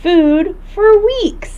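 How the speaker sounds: high slow delighted marvel; wonder. Acted. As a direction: draw it out in awe. Gasp then slow marvel.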